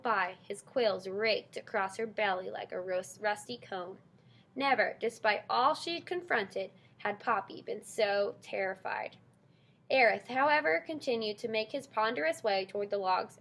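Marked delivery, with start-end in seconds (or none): none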